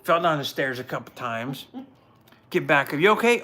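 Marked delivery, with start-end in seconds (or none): 3.0-3.4 calling out. Retold or imitated. Wife calls after stairs fall.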